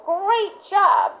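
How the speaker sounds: high, bright burst of praise; praising the dog. Retold or imitated. Trainer praising dog after effort, high excited praise. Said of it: "and then I'm gonna go".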